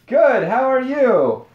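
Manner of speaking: slow, loud, flustered echo; awkward repeat. Retold or imitated. [name] fumbling his reply to the girl, slow awkward echo. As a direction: blurt the same line back, stuck. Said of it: "Solid, [name]".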